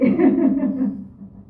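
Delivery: loud, high burst of laughter; amused, voiced laughing. Real, not acted. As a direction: laugh out loud at your own wish. Laughs about affirming the purchase.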